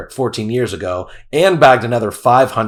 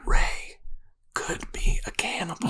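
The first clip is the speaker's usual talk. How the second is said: whispering; 5 semitones higher, 10 dB quieter, slower than usual. Acted. Spooky hint about neighbor.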